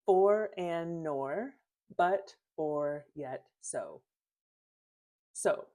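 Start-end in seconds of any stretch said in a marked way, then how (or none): 0.1-4.0 slow, spaced-out list of conjunctions; deliberate teacher recital of a word list. Shown as a demonstration. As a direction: lay each word down slowly, one by one. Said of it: "I'm gonna put the fanboys".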